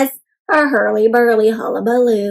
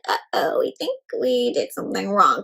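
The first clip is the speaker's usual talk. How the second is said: guilty whisper; 5 semitones lower, 8 dB quieter than usual. Acted. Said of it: "They're a little like".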